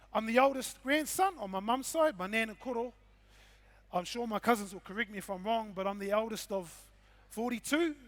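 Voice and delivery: ordinary talk, nothing marked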